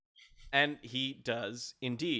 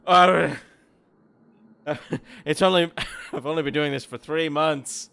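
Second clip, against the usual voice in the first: laughing through the fall; 4 semitones higher, 7 dB louder than usual. Acted. Kobold after landing on head.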